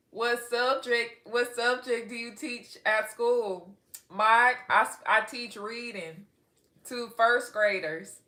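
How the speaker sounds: slow, stretched-out country accent; put-on, leisurely country reading voice. Shown as a demonstration. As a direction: drag the words out in a Texas twang. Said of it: "This is my country accent".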